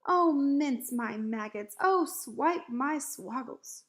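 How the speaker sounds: slow, loud lament; dismayed cry. A narrator's reading. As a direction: wail each oath, drawn out. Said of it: "he cried".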